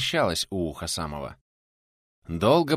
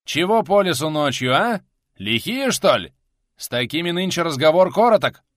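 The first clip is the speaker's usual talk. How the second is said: loud, high-pitched interrogation; threatening challenge; 6 semitones higher, 7 dB louder than usual. A narrator's reading. Rider questioning suspected outlaws, loud threatening challenge. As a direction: challenge them forcefully at full voice.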